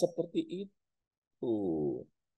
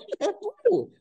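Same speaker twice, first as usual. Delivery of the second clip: mocking. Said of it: "Itu Yesus ngomongnya".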